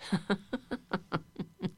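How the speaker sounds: soft, hushed snicker; sneaky laugh. Shown as a demonstration. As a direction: giggle quietly like you're sneaking. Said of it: "And a little quieter".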